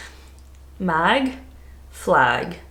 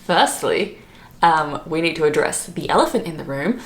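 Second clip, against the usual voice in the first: ordinary delivery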